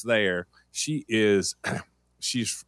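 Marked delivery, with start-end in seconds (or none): none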